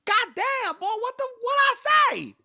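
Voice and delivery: very high, very loud mock screech; furious mom. Mom hears the boys loud again, screeching furiously. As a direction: explode as a mom yelling from the next room. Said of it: "We hear the mom".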